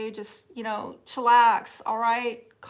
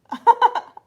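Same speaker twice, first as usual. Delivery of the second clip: loud, high-pitched laughing fit; burst of laughter; 12 semitones higher, 11 dB louder than usual. Real, not acted. Streamer teasing viewers about her Southern drawl, bursting out laughing. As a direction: crack up loudly at your own teasing.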